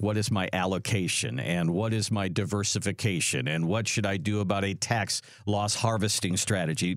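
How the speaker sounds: mock jargon client. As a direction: mimic an investor showing off jargon. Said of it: "People don't talk like that".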